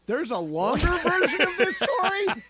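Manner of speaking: high laugh through words; incredulous, laughing high; laughing disbelief. Retold or imitated. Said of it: "all I could think was".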